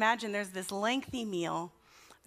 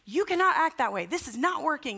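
raised, higher-pitched telling-off; retold scolding; 5 semitones higher than usual. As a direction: imitate a fed-up parent scolding a child. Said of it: "I was yelling at him".

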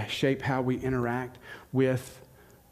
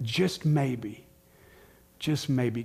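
slowed emphasis; much slower than usual. Acted. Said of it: "repetition slowed for emphasis".

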